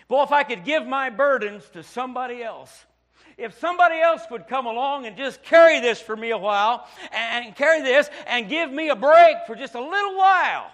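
loud, rising preacher's plea; preaching at full force. Acted.

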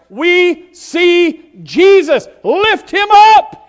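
very slow, loud, high shout; preacher lifting the crowd to the climax; drawn-out preaching cry. Acted. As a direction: stretch every word into a triumphant call. Climax of refrain.

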